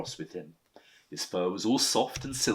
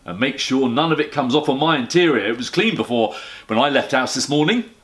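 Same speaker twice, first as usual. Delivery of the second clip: loud, gruff cabbie grumbling; a loud, put-out taxi driver; grumpy taxi driver; 3 semitones higher, 9 dB louder than usual. Acted. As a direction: complain like a cross London cabbie.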